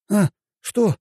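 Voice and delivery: put-on high-pitched, indignant young man just woken; sleepy lad's high indignant yelp. A narrator's reading. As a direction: protest in a high, startled voice. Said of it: "возмутился он".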